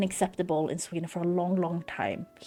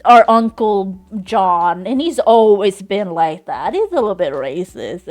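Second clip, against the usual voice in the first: mocking excuse-maker; 12 dB louder than usual. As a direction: parody someone shrugging it off. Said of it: "that person who's like".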